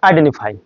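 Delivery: আইডেন্টিফাই with the T left out, idenifai; dropped T. Shown as a demonstration. Teacher showing the American dropped T in আইডেন্টিফাই. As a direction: drop the T in আইডেন্টিফাই. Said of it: "টিটা কিন্তু omit হয়ে".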